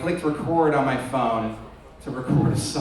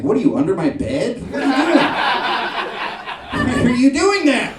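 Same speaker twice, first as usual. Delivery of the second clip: high, loud alarmed questions, laughing; mock freaked-out; 8 semitones higher, 6 dB louder than usual. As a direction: yelp like someone found under the bed. Freaked-out listener reacts.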